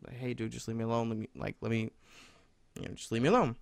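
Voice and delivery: quiet, low-key retelling of a plea; restrained tone. Retold or imitated. Student asking staff to leave him alone, restrained tone. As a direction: keep it down and contained, softly. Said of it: "trying to keep my tone, you know, down".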